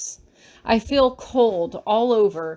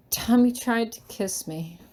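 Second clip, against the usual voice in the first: ordinary delivery, nothing marked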